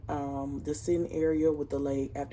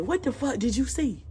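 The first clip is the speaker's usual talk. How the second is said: higher, louder incredulous question; retold disbelief; 7 semitones higher, 4 dB louder than usual. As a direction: demand to know what she saw. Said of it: "We still like".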